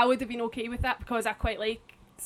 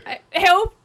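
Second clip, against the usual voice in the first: mock cry for help; 8 semitones higher, 8 dB louder than usual.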